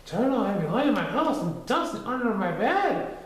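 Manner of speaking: high small pig voice; timid Piglet. A narrator's reading. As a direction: squeak the plan anxiously.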